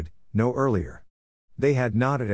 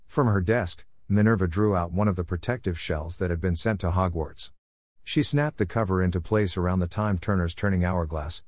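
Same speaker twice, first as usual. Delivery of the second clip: usual speaking voice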